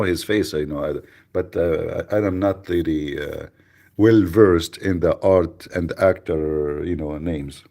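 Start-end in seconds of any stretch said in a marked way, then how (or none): none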